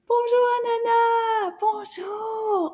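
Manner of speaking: high, delighted singsong hello; excited greeting. Pretend. Teacher greeting Anana as she arrives, excited. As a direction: greet a puppet friend in a high voice.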